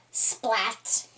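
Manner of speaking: ordinary delivery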